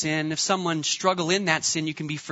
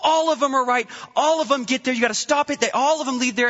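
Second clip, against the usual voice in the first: higher, insistent mock rant; a retold stranger's higher, insistent rant at the leader; agitated man; 6 semitones higher than usual. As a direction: imitate the upset stranger pressing his point. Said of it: "He comes up to me as a leader".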